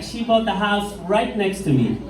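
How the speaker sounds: usual speaking voice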